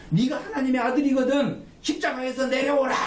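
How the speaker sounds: high, forceful imitated crowd shout; jeering shout. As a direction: call up at him like a taunting mob. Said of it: "라고 소리쳤습니다".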